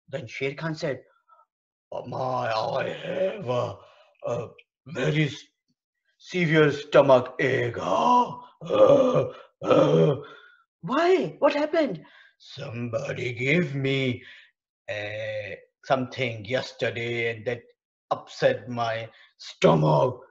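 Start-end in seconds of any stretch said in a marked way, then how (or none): 1.9-7.8 groaning sick lion. Acted. Lion with stomach ache. 7.8-10.2 pained groan. Acted. Lion groans in pain. 10.8-12.0 put-on high child voice. Child asks lion. 12.4-20.2 groaning sick lion. Acted. Lion with stomach ache.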